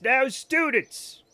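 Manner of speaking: high, slow lecturing teacher; mock schoolteacher explaining. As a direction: talk down to the class like a patient teacher. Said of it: "And the teacher says".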